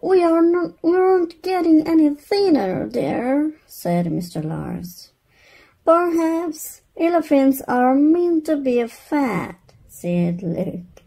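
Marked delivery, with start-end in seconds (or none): 0.0-3.5 high, fast complaining voice; whiny dad. Acted. Husband complaining to wife, high and whiny. 5.8-9.6 put-on higher, innocent child. Luke telling his parents, high and innocent.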